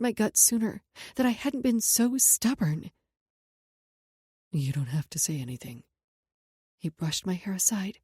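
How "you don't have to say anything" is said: tender. A narrator's reading. Jackson soothes Sloan.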